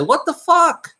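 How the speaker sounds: very high, incredulous outburst; acted disbelief. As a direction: act out a stunned realization, pitch way up. Acts out seeing past lives.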